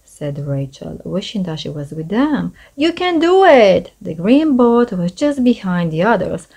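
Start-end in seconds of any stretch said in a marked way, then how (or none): 2.8-3.9 high cheer from the bank; root for the team out loud. A narrator's reading. Cheering the boat race on.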